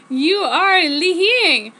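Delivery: slow, high and loud screamed accusation. Acted. Edward screaming at Em that he lies, slow and loud. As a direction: scream it out, drawn out and furious. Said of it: "Edward screamed".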